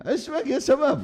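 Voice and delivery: high-pitched mock scolding; old man's scolding. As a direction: imitate an elder telling off a youngster. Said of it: "pernah dibentak orang sudah sepuh".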